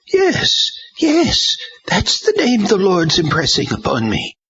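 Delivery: put-on a shaken old woman insisting; old woman's cry. A narrator's reading. Edith to the saints, high old woman's cry.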